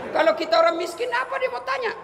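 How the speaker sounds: a hint of a high, nosy angel asking questions; questioning angel. Angel grills Inem about her life, lightly voiced and high.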